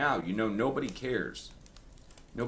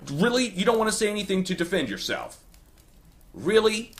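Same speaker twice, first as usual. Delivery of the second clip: incredulous disbelief; 10 semitones higher, 4 dB louder than usual. Real, not acted. Incredulous challenge to accusers.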